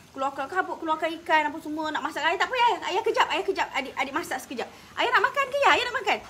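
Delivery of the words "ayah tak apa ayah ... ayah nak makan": higher, hurried and flustered, caught off guard; a daughter scrambling to reassure her father; flustered reply to father. Retold or imitated. (gugup dan kelam-kabut menjawab ayah)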